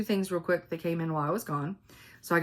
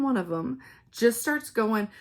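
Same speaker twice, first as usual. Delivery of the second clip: usual speaking voice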